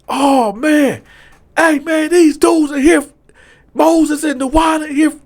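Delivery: high, loud shout to the whole tier; shouted alert. Retold or imitated. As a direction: call it out as loud as you can.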